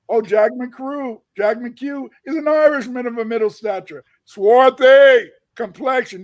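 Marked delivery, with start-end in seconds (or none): 4.3-6.2 swarthy slow and loud, falling; stress on swarthy. Shown as a demonstration. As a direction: linger hard on swarthy. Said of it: "You know what swarthy means?".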